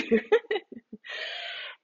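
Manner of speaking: short, high laugh; a real laugh breaking out after the quote; amused laugh. Real, not acted. Mother recalling her daughter's puzzled question, laughing.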